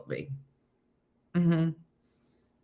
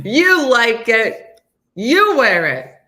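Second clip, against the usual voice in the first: insisting; 5 semitones higher, 9 dB louder, much slower than usual. Real, not acted. Urging Missy to own jacket.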